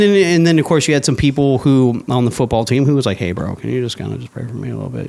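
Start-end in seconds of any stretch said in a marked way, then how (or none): 3.2-5.1 softer, fast, secretive ask; hushed teammate request. Retold or imitated. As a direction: lean in and ask a favour under your breath. Teammate quietly asking for prayer.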